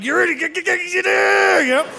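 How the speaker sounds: high, exasperated cop shouting; furious cop losing it; angry cop yell. Retold or imitated. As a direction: blurt it at the idiots in frustration. Angry cop yelling at them.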